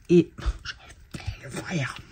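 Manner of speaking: slow whisper to herself; slow whispered aside. Real, not acted. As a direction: murmur it under your breath.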